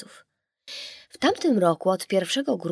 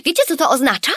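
put-on high, louder child's voice; eager girl's question; 7 semitones higher, 8 dB louder, faster than usual. A narrator's reading. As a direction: ask it like a thrilled little girl. (podekscytowane pytanie dziewczynki)

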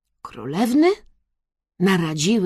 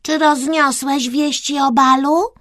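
high, louder royal question; little princess checking on servant, pitched up; play-acting princess; 7 semitones higher, 5 dB louder than usual. A narrator's reading. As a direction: question your court herald grandly. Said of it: "zwróciła się Kreska do gołębia".

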